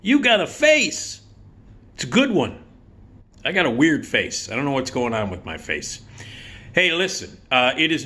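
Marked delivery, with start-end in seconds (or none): 0.0-2.6 Joe Donatello. Acted. Comic greets his audience as his late buddy, brash impression. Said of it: "I sound like my old buddy Joe Donatello". 6.7-7.4 lightly put-on buddy's catchphrase; Joe Donatello. Comic echoes his late friend's catchphrase, light impression. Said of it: "I sound like my old buddy Joe Donatello".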